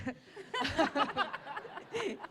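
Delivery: long high laugh of delight. Real, not acted. Actress laughing after Cate's compliment. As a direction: let the thrilled laugh spill out.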